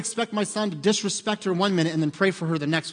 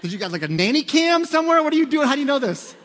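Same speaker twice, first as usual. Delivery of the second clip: loud, much higher, laughing disbelief; mock shock; 10 semitones higher, 7 dB louder than usual. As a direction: act caught out by your son, half laughing. Dad's shocked reply to son.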